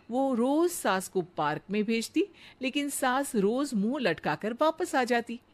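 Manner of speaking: usual speaking voice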